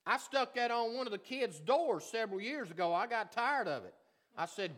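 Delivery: loud, high-pitched pulpit delivery; full-force preaching. Real, not acted. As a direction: drive it home to the congregation, loudly.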